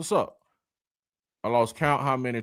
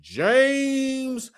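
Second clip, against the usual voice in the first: loud, much higher hype shout; shouted greeting; 13 semitones higher, 8 dB louder, much slower than usual. Real, not acted. Livestream host hyping up a viewer's arrival, shouting the greeting. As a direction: yell out a welcome to a viewer.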